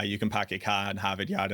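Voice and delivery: dropped r's in a Boston accent, park the car in Harvard Yard. Shown as a demonstration. Asked about his accent, he shows off the Boston accent. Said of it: "you tell people to ask about your accent".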